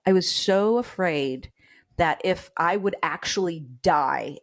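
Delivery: ordinary talk, nothing marked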